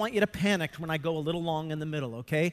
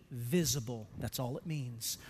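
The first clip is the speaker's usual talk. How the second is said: quiet emphasis; 9 semitones lower, 9 dB quieter than usual. Acted. Drops voice on key point.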